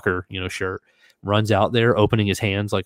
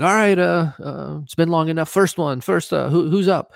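host calling out; 9 semitones higher than usual. Retold or imitated. Voicing host calling volunteers.

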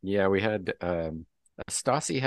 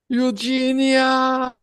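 long, high, loud cry of a name; drawn-out, hammed-up anguished scream; upset husband's scream; 10 dB louder, much slower than usual. Doctor tells husband his wife is in a coma, anguished scream. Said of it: "a very upset husband".

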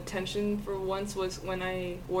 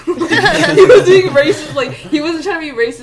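laughing; 7 semitones higher, 16 dB louder than usual. Real, not acted. Laughing while telling story.

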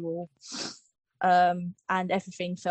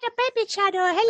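high-pitched mock baby talk; playful baby voice; 11 semitones higher, 4 dB louder, faster than usual. Young channel greeting listeners as a baby, playful baby voice. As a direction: squeak it like a pretend infant.

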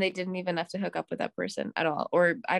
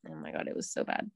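ordinary talk, nothing marked

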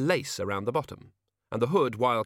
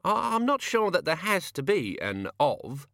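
slow, higher, stumbling academic; hesitant scholar voice; 7 semitones higher, slower than usual. A narrator's reading. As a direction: get out the words slowly, unsure. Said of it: "The Senior Wrangler coughed".

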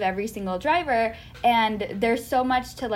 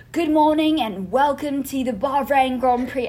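posh British race host, raised pitch; British announcer voice; 3 semitones higher than usual. Retold or imitated. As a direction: greet like a Grand Prix presenter. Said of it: "I was trying to be him".

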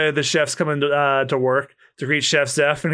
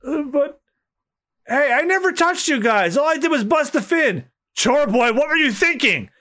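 higher, startled pirate voices; higher, outraged pirates who won't let their ship sink; shocked pirates; 11 semitones higher than usual. Acted. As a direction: react in disbelief to the threat.

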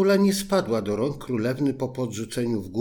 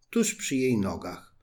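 ordinary delivery, nothing marked

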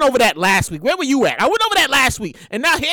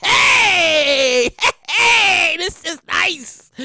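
excited; 3 semitones higher, much slower than usual. Retold or imitated. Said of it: "I was excited, like".

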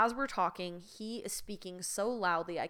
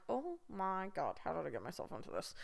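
exasperated aside; 8 dB quieter than usual. Retold or imitated. Inner exasperation at awful date.

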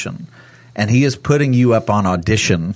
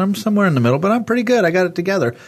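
high, mock self-satisfied voice; smug self-assurance; 10 semitones higher, much faster than usual. Mocking a churchgoer who thinks he's good enough, smug. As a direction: parody someone sure they're fine.

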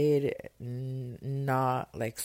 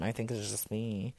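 ordinary delivery

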